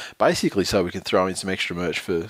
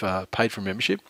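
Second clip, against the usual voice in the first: ordinary delivery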